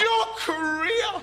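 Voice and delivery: incredulous shout. Acted.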